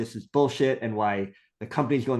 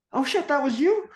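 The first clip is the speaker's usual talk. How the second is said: very high-pitched amazed question; squeaky mock astonishment; astonished recognition. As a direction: act out sudden happy disbelief.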